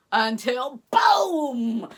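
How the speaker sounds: slow, loud booming shout; dramatic boom. A narrator's reading. Storyteller to children, a loud slow boom. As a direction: make the thunder crash.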